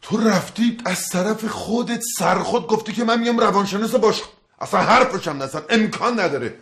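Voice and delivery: high-pitched, indignant objection; indignant protest. Acted. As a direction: protest at being volunteered behind your back. Protests being volunteered for job. (با اعتراض و عصبانیت)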